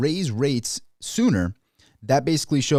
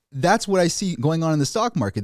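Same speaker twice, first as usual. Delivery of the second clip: ordinary delivery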